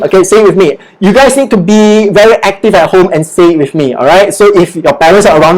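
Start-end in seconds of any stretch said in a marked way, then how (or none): none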